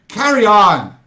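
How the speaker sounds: loud call to carry on; shouted order. Acted. Director shouting at the cast to carry on. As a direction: bark it across the stage. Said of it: "shouted the director".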